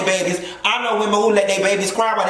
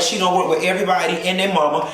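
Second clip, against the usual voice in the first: ordinary delivery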